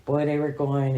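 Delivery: normal talking voice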